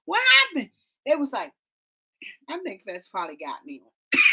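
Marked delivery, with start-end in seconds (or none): 0.0-0.7 high, frightened asking with eyes shut; too scared to look, begging to know; scared pleading. Retold or imitated. Eyes shut in the cable car, asking the couple, high and scared. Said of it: "I said".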